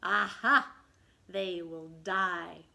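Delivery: slow, low, gloating pirate captain; Hook gloating. Acted. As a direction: savour the threat like a villain. Hook gloats over his plot.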